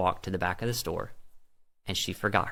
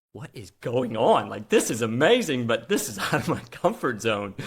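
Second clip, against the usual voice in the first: amazed; 3 semitones higher, 4 dB louder than usual. Retold or imitated. Amazed by the healing.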